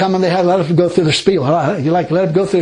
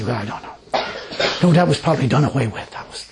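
mock mumble; 5 semitones lower, 4 dB quieter than usual. Mocking what the ladies will say.

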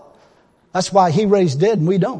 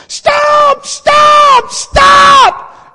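high, drawn-out full-voiced shouts; sudden yelling; 8 dB louder, much slower than usual. Preacher retells Wigglesworth startling his driver, sudden loud yelling. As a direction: imitate a passenger yelling in sudden alarm. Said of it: "starts yelling".

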